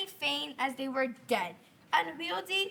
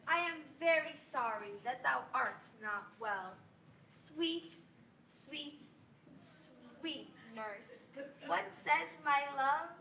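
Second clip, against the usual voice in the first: coaxing; 3 semitones lower, 7 dB quieter than usual. Acted. Juliet coaxes the nurse sweetly.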